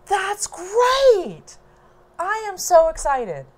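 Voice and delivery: high and thrilled; delighted brother. Retold or imitated. Brother hearing baby news, imitated high and thrilled. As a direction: gush like an overjoyed brother.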